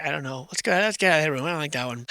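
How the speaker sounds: ordinary delivery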